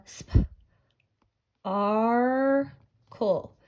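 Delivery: "sp, ar, cool" with long gaps, the ar held; segmented sounds. Shown as a demonstration. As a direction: puff out sp, stretch ar, finish on a short cool. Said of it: "I hear the".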